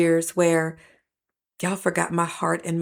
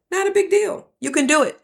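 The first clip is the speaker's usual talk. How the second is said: much higher, airy brush-off; mock breezy dismissal; 10 semitones higher than usual. Preacher mocking a careless apology attitude, airy high brush-off. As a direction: toss it off like it's nothing, mock-cheerful.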